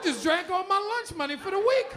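high, mocking yell at her; hammed-up indignant yell; mock outrage. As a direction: jab at her like a stingy date. Mocking date at bill time.

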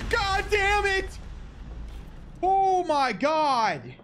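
frightened high curse; startled shriek, slow and drawn; scared horror game streamer. Real, not acted.

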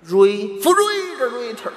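Refrigerator with an overblown, drawn-out second syllable; stress on second syllable. Shown as a demonstration. Student obeying the stress correction, lopsided emphasis on Refrigerator. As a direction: punch and stretch the FRI in Refrigerator. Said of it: "重音搁第二个音节上".

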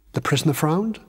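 ordinary delivery

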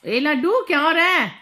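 high, loud playful call. Real, not acted. Mother calling out to the dog, high and loud. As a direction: call the dog across the room.

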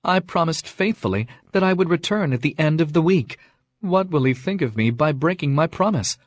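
fretting over a broken promise; worried, pleading tone; Beauty distressed. Acted. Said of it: "she cried".

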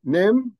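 name said as nem, high and loud, rising; pronounced nem. Shown as a demonstration.

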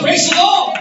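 loud cry of praise; rousing shout of praise. Real, not acted. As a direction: call it out loud to the church. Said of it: "Everybody shout".

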